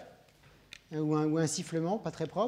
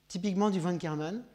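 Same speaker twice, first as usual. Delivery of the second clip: ordinary delivery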